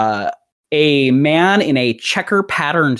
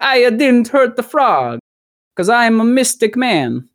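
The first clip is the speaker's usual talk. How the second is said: slightly slowed, lightly voiced Italian mystic; a hammed-up mystic man boasting; mock Italian mystic; 5 semitones higher, slower than usual. Narrator voicing the mystic man after he punches the frog, mild mock Italian. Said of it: "This guy's Italian".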